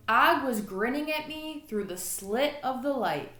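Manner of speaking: ordinary delivery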